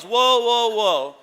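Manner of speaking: wife cutting off her husband, firm and loud; loud warning whoa. Retold or imitated. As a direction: stop him in his tracks. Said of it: "She goes".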